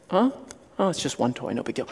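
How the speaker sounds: soft, low, offhand murmur; unconcerned kid. Acted. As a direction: shrug it off quietly. Kid shrugs off a missing toy.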